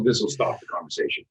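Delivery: ordinary talk, nothing marked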